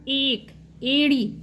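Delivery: एक and एड़ी said slowly, ए lengthened; teacher modelling एक, एड़ी; slow demo words. Shown as a demonstration. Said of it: "ए से कोई भी वर्ड स्टार्ट हो रहा".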